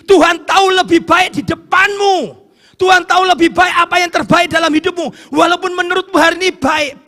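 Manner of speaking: loud, high, fervent preaching; preaching at full force. Acted. Preacher warning the congregation against self-chosen paths, fervent shout. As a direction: drive the warning home to the congregation.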